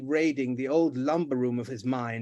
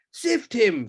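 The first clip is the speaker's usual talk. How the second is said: loud, higher scream; 7 semitones higher, 6 dB louder than usual. Acted. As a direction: scream the order. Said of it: "screamed the Duke".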